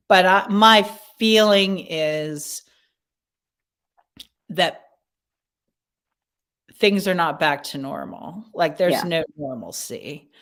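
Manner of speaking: slow and halting, long pauses between phrases; talking slowly, thinking. Real, not acted. Guest running through organizations in their head, talking slowly. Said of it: "I'm actually talking slowly 'cause I'm".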